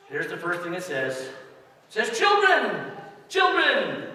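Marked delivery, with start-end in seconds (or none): 2.2-4.1 put-on loud, high calling out to the kids; ringing, high call of "Children, children". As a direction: call the children like a stern parent.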